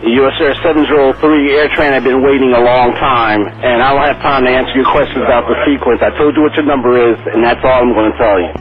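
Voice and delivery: loud, exasperated telling-off; fed-up scolding. Real, not acted. Controller shutting down a pilot's questions, loud and fed up. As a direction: lay down the law, loud and impatient.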